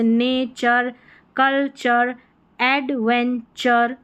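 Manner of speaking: नेचर, कल्चर, एडवेंचर split slowly before final char; slow syllables in नेचर, कल्चर, एडवेंचर, ending in char; TURE as char. Shown as a demonstration. Teacher modelling TURE endings, slow char demonstration. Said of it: "इसको चर करके pronounce करेंगे".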